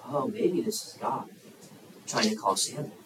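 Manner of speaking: low, soft musing; dawning realisation. Acted. Priest finally recognizes who is calling, musing softly. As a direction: think it through quietly to yourself. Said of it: "Eli finally recognizes".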